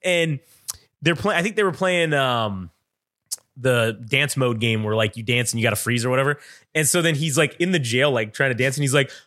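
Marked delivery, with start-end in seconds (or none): none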